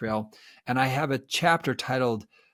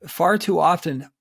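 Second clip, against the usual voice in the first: normal talking voice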